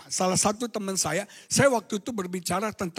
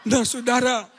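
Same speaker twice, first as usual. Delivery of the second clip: normal talking voice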